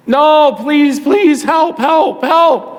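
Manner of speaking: very high, loud begging; desperate yelling. Acted. Ignored mother still yelling after Jesus, acted high-pitched desperate begging. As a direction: cry out insistently, stretching the pleas. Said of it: "she just started yelling".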